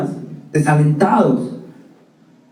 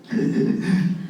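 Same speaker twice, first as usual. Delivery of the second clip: soft laugh; 2 semitones higher, 7 dB quieter than usual. Real, not acted. Laughs at his own story.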